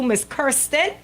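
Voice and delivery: normal talking voice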